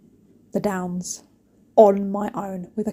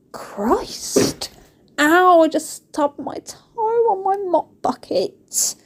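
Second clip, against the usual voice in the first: high, drawn-out yelp of pain; real pained outburst; 9 semitones higher, much slower than usual. Real, not acted. Vlogger has just stubbed her toe, pained outburst. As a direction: stretch the words out high through the sting.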